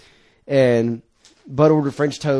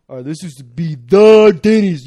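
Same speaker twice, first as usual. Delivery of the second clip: imitating Tom; 4 semitones higher, 6 dB louder than usual. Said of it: "Tom's like".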